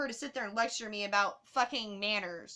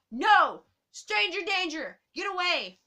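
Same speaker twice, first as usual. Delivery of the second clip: loud, higher mock alarm yelling stranger danger; mock panicked shout; 6 semitones higher, 7 dB louder, slower than usual. To a stranger offering to watch her bag, mock alarmed shout. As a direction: shout it like a scared kid warning off a stranger.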